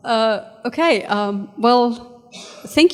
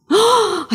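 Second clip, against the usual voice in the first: high sharp gasp; startled gasp; 12 semitones higher, 3 dB louder than usual. Acted. Lewis recognising MacDonald on the bus, high awed gasp. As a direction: gasp in awe at meeting him. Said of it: "I cried".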